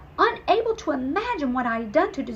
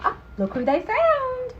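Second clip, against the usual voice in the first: excited reveal; 13 semitones higher, 6 dB louder than usual. Acted.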